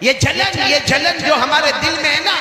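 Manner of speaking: high, emphatic sermon cry; impassioned preaching. Real, not acted. Preacher warning congregation about envy in their hearts, impassioned. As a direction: hammer the point home, pitched high.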